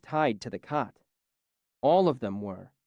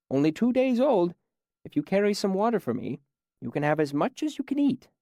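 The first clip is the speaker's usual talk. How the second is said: put-on higher, patient old man voice, mildly surprised; friendly old man; 6 semitones higher than usual. A narrator's reading. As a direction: greet the stranger kindly. Old man speaks to stranger.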